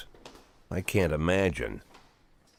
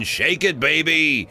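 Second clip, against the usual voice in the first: high, rowdy party holler; whooping, high-pitched raucous shout; rowdy yell; 11 semitones higher, 3 dB louder, slower than usual. Acted. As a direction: holler it out with wild abandon.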